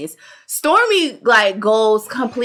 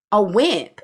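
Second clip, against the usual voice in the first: ordinary talk, nothing marked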